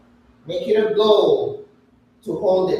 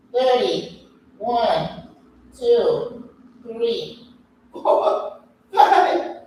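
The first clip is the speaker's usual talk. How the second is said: shaking with effort; 4 semitones higher, much slower than usual. Real, not acted. Counting out the hold.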